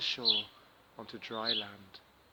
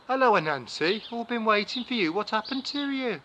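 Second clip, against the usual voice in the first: much higher, much louder hearty greeting; Turtle welcome; 9 semitones higher, 11 dB louder than usual. Acted. Turtle welcomes Anansi at his door, loud and high.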